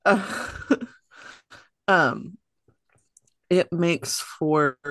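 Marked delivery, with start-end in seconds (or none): 0.0-2.4 hiccup then a little laugh; catching a hiccup between words. Real, not acted. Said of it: "I have the hiccups".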